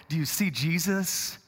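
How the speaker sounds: ordinary delivery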